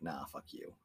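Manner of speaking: whispered nah fuck you; mock dismissive whisper. God refusing to help humans, dismissive whisper. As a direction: brush them off under the breath.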